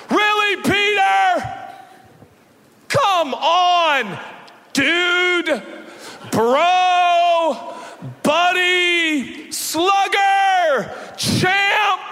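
high, loud, fed-up shout; exasperated shout. Retold or imitated. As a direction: yell it like a fed-up coach scolding. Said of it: "show up on the scene and say".

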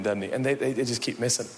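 ordinary talk, nothing marked

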